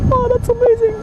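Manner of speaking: high, delighted exclamation; amazed. Real, not acted. Gamer thrilled to ignore the cars, amazed. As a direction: squeal with real amazement.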